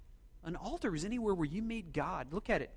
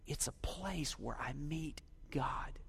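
hushed, breathy whisper; 3 semitones lower, 8 dB quieter, slower than usual. Acted. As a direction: lean in and whisper it. Intimate point in sermon.

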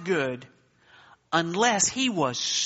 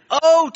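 "oh" called out high and loud; ringing oh; 9 semitones higher, 9 dB louder than usual. Shown as a demonstration. Preacher showing what oh adds, ringing "oh".

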